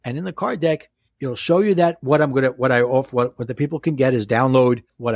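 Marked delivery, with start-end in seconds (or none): none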